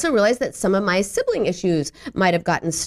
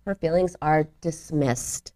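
ordinary talk

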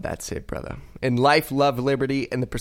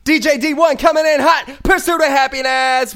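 much higher, louder hype call; hype announcer; 12 semitones higher, 8 dB louder than usual. Acted. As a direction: hype the crowd like a radio DJ. DJ hyping the song intro.

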